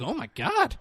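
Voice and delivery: high-pitched acted astonishment; shocked judge. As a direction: gasp it out as a stunned onlooker.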